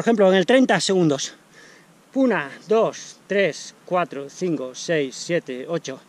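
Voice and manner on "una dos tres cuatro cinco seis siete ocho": very slow count, fading to near silence; slow pulse count. Shown as a demonstration. Counting his own pulse aloud to viewers, slow and fading. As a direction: count the beats slowly, dropping softer and lower. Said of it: "Ahí me la estoy contando".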